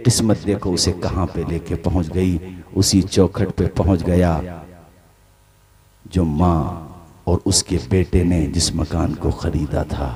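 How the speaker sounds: low and soft, almost breathless suspense; hushed storytelling. A narrator's reading. (धीमी, दबी हुई कथा-वाचन की आवाज़)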